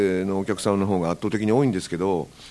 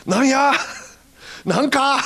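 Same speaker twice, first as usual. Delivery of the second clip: much higher, louder Hakata challenge with a laugh; 3 dB louder, slower than usual. Retold or imitated. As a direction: hurl the last word in Hakata dialect. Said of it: "ひときわ大きな声で". (大声で博多弁の啖呵)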